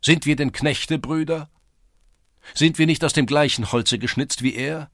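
high, loud rhetorical questions; fiery appeal to a crowd; rousing challenge. A narrator's reading. Rallying the lads with challenges.

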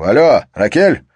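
loud, high shout; shouting into phone. Acted. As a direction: yell into the phone.

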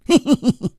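put-on high, short boyish giggle; light snicker. A narrator's reading. Boy amused by lovesick adults, light high snicker. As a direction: snicker to yourself through a grin. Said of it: "他咧开嘴儿轻笑了几声".

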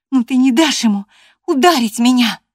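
high, very loud appeal; alarmed exclamation. A narrator's reading. Catherine appeals to Gerton against a blow, exclaiming. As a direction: exclaim it loudly, pitched well up. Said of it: "воскликнула она".

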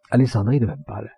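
ordinary delivery

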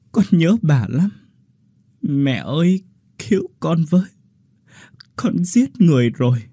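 put-on a girl breaking down in sobs to her mother; weeping, begging to be saved; sobbing plea. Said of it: "rồi khóc nức nở".

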